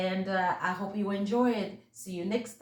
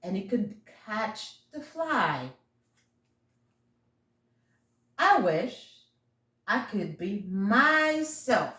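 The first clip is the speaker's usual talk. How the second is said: gentle storybook read-aloud; reading to children; 4 dB louder than usual. A narrator's reading. Reading the chameleon book to her young listeners, gentle storybook voice. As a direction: read it like bedtime story time.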